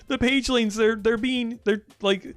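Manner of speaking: high, frantic, urgent pleading; breathless high-pitched cartoon panic; excited Finn. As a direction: cry out to save the little page creatures. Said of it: "And Finn is like".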